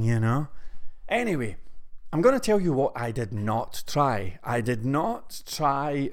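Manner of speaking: usual speaking voice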